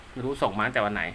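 ordinary delivery

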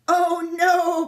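high, loud wail; alarmed sheep cry; bleating sheep. A narrator's reading. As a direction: bleat it in dismay. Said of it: "bleated the sheep".

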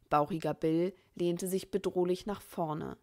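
ordinary delivery

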